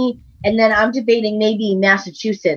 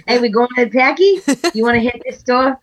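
put-on Boston buddy voice; Boston accent; 3 semitones higher than usual. Co-host voicing the friend's store run, Boston accent. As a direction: invite a pal in Boston accent. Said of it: "friend who has a Boston accent".

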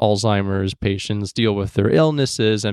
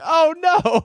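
mock horror. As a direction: squeal it out in fake alarm. Mock horrified reaction to story.

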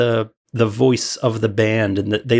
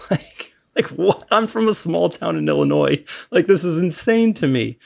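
high, laughing "what?" of disbelief; 7 semitones higher than usual. Real, not acted. Small-town guy laughing in disbelief at touring the world. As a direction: laugh at how unreal it all was.

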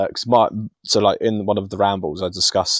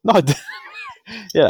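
usual speaking voice

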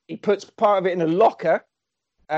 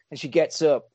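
normal talking voice

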